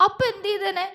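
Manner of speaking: wife asking through tears what might have been; tearful. A narrator's reading. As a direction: wonder aloud through tears. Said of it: "കണ്ണു നിറച്ചുകൊണ്ട് പുഞ്ചിരിച്ചു".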